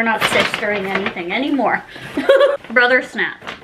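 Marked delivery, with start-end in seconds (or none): 1.9-2.7 a quick high giggle. Real, not acted. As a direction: giggle at her own joke. Laughing at own joke.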